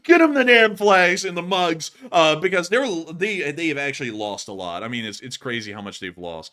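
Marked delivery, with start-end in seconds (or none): 0.0-1.9 loud, high, slowed barking order; mock boss order. Streamer voices a grumpy boss demanding flags and mugs. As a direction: snap it out like an exasperated boss. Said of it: "it's gonna be like".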